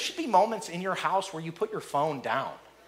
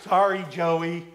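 put-on slow, sulky forced sorry from a child; grudging kid apology; 5 dB louder, much slower than usual. Child forced to apologize to brother, sulky mumble. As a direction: mumble it like you don't mean it.

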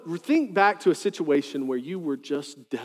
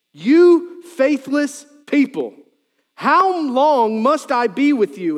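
louder and higher, slow, exasperated; frustrated rebuke; 8 semitones higher, 7 dB louder, slower than usual. Acted. Jesus rebuking the faithless crowd, loud and exasperated. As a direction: drag it out, fed up with them. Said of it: "He gets frustrated".

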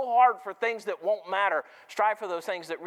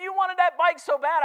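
high, raised father's voice; dad scolding; 10 semitones higher, 7 dB louder than usual. Retold or imitated. Father reproaching his son over the ruined bike, raised and high. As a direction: remind him like a let-down dad. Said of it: "He said".

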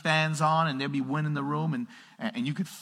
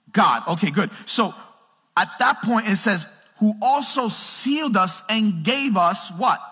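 loud, high, slow scripture proclamation; full-force preaching; 6 semitones higher, 8 dB louder, slower than usual. Real, not acted. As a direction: drive each phrase home to the congregation.

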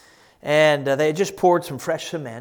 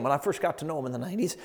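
normal talking voice

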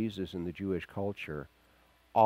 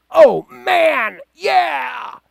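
wild high shout of delight; crowd cheering; 16 dB louder, much slower than usual. Retold or imitated. Astonished crowd yelling oh man yeah at Jesus' answer, wild cheer. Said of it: "They're going".

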